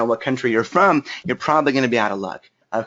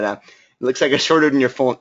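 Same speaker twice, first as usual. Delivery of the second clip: ordinary delivery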